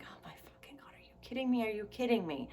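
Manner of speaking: soft, muttered parental exasperation; exasperated parents. Retold or imitated. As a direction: groan it like a tired parent at Christmas. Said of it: "our parents must have just been like".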